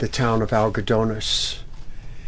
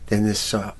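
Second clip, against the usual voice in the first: usual speaking voice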